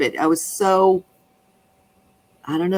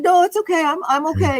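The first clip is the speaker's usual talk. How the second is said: higher, lightly mocked brush-off; imitating herself reassuring; 10 semitones higher than usual. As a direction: play down the fuss, lightly voiced. She reassures the driver.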